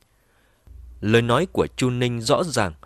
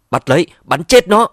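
shouting; 8 semitones higher, 10 dB louder than usual. Acted. Ordering men to shoot.

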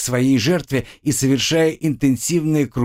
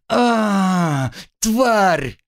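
pained cry; 10 semitones higher than usual. A narrator's reading. Girl cries out in pain.

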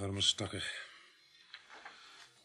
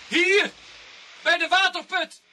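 loud high shout; urgent call; 9 dB louder, slower than usual. Acted. Constable calling the others to the well, loud urgent shout. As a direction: call them over, urgent.

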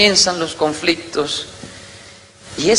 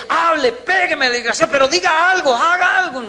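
furious wife; 10 semitones higher than usual. Retold or imitated. Wife provoking her silent husband, very high and furious. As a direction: goad him to react at all costs.

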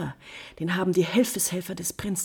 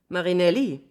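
normal talking voice

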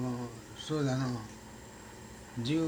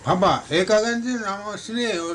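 higher, louder quoting of the son; young son's voice; 10 semitones higher, 6 dB louder than usual. Retold or imitated. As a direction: voice the boy, pitched up and loud. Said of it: "言うて言うたの".

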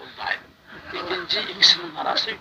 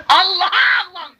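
urging shout; 10 dB louder than usual. Retold or imitated. Urging children to cry to God.

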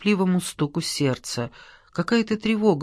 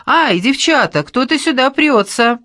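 high, loud squeal of alarm; startled squeal; 8 semitones higher, 6 dB louder than usual. A narrator's reading. Томка warning the girls someone is coming in, squealing. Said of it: "Томка взвизгнула".

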